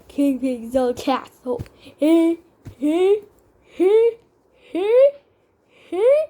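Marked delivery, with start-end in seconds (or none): 1.8-6.2 high rising cackle. Pretend. Toy villain cackles at the hiding birds, high rising giggle. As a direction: giggle climbing higher and higher, loud. Toy character gleeful laugh.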